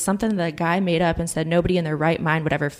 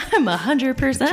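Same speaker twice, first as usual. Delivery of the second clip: laughing; 9 semitones higher, 4 dB louder than usual. Real, not acted. Joking about one and done.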